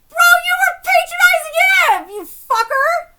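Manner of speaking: high, loud indignant yell; heated, exasperated fan outburst; outraged shout. Real, not acted. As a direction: lay into the character at full voice. Outraged rant at manga character.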